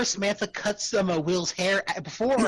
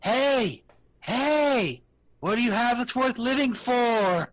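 calling out; 6 semitones higher, 3 dB louder, slower than usual. Acted. Calling out to the creature.

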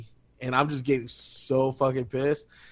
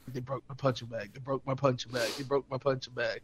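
much softer, repeated low grumble; muttering to self; 4 semitones lower, 10 dB quieter than usual. Retold or imitated. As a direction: grumble it under your breath. Muttering to himself, upset.

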